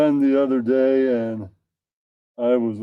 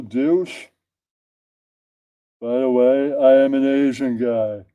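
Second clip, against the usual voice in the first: ordinary delivery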